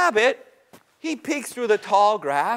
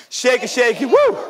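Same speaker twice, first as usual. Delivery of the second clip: shaking branches. Acted. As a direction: chant it and leap up on "woo". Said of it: "started shaking like this".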